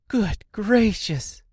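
high, slow astonished gasp; surprised cry. A narrator's reading. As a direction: exclaim in amazement. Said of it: "she cried".

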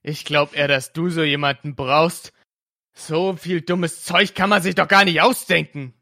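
loud, cutting comeback; loud angry reply to the aunt; angry retort. Acted. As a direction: throw the insult back at her. Julian snapping back at aunt.

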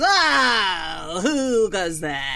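slow, wary call into the dark; sentry calling out a challenge, drawn out. Acted. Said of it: "So Squishy Chewing goes".

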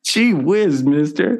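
put-on high eager gee-whiz kid voice; wholesome 1950s kid. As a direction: play an innocent old-timey kid. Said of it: "one of those Ernest movies".

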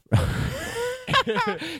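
high squealing cackle; hammed-up diva cackle; mock shrill cackle. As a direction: shriek a laugh like a drag villain. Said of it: "and she says".

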